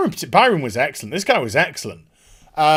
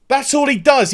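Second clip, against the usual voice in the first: usual speaking voice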